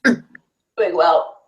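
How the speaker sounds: tight voice holding breath. Real, not acted. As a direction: talk with lungs full. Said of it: "hold your breath with me".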